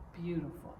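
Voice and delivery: plain reading voice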